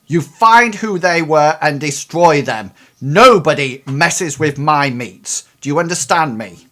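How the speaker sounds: loud threatening order; angry boss. Acted. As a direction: lean in and threaten. Irate troll boss voiced.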